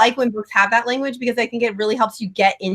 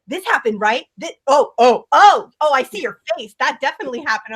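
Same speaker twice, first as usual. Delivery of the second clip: higher-pitched imitation of catching someone out; dawning realization; 4 semitones higher than usual. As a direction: act out a sudden giveaway discovery. Retells partner reading her reaction.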